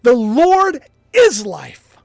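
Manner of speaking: loud, slow, each word pressed; slow emphatic preaching. Acted. Preacher pressing viewers to hold fast. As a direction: drive it home like a sermon.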